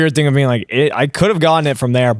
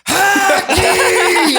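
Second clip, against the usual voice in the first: very high, drawn-out outburst; Darcy. Retold or imitated. Mimicking a friend rejecting a joke idea, high exaggerated impression. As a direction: blurt it out as a hammed-up, squealed verdict. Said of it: "That's a pretty accurate Darcy impression".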